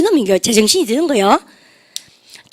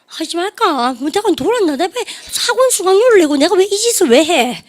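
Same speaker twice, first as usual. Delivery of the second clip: higher-pitched mock despairing grumble; exasperated self-doubt; 6 semitones higher than usual. Teacher voices a student regretting the paid course, mock exasperation. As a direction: act out a student questioning the whole thing.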